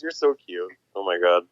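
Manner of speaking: usual speaking voice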